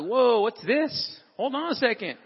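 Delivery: slow, drawn-out startled whoa; a slow double take at teen behaviour; startled dad. Retold or imitated. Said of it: "I'm like".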